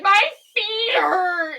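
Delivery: loud, high, drawn-out whine; mock child wailing a complaint; imitating Amelia. Daughter complaining to dad while hiding, exaggerated high wail. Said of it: "Or she'd be like".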